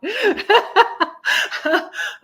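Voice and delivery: a long, high run of giggles; high giggling laugh. Real, not acted. Remembering her friend's teasing, long high giggle.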